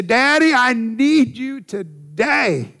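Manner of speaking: pleading cry. Acted. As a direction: cry out for help, worn out. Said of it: "we just say".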